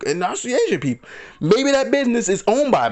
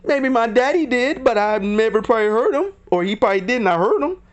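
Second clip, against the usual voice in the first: mocking woman voice; 6 semitones higher than usual. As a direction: mimic her fumbling excuse, pitched up. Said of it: "she was like".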